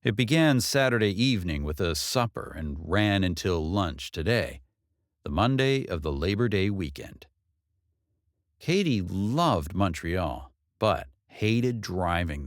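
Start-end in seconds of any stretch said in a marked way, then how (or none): none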